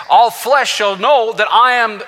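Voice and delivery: high, loud, slow scripture proclamation; full-force preaching. Acted. Preacher quoting Isaiah to the congregation, full-force preaching. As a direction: ring it out as God's own declaration.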